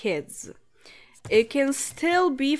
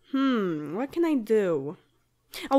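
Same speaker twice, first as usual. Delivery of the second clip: ordinary talk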